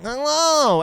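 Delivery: high, loud drawn-out alien hello; E.T. greeting. Retold or imitated. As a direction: squeal out a mock alien greeting. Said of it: "E T's like".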